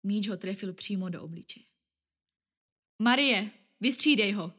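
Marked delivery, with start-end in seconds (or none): 3.0-4.5 teacher's shrill call. Acted. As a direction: call the girl onto the court. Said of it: "zapískala učitelka".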